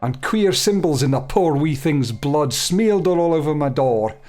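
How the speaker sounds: a fading Scottish burr on the smith; Scottish accent. Acted. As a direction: let the Scottish accent slip as you go. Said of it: "I'm losing the accent here".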